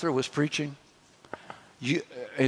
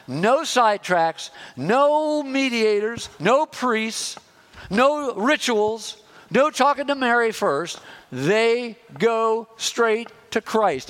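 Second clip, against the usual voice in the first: rising preaching emphasis; 6 semitones higher, slower than usual. Acted. Preacher building a list to climax.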